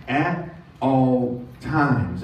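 very slow "At all times"; preacher slowing to stress "At all times"; slow emphatic reading. Shown as a demonstration. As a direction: space out every word. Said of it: "very important instructions".

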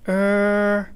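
high, held, awkward hesitation; hesitant kids. A narrator's reading. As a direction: stall on one high note like an uneasy pupil. Kids hesitate to answer teacher.

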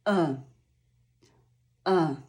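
E as short, low "uh", each on its own; low frog vowel. Shown as a demonstration. Teacher has learners feel the sacs, low frog "uh" for E. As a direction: drop the tongue right down for E, "uh". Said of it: "język musi znaleźć się bardzo nisko".

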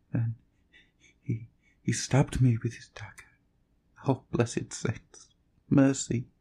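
broken whisper rising to a high cry for mercy; dying plea. Acted.

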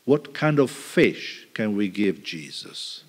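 usual speaking voice

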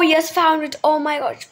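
ordinary talk

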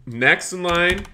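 cheerful counter-clerk call; calling next customer. Acted. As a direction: sing out to the queue like a shopkeeper. Serving customers at the truck.

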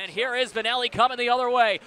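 ordinary talk, nothing marked

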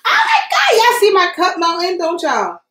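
very high, loud alarmed squeal; shrieking panic. Real, not acted. Crafter seeing her cup melt on live, high squeal.

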